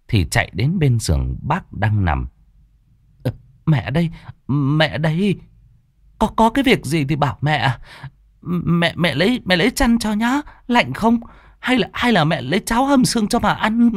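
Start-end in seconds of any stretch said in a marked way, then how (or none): 3.2-13.9 put-on high, fluttering old mother's voice, much higher; an anxious old mother fussing over her sick son; fussing grandmother. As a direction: grandmother rushing to the bedside, high and flustered.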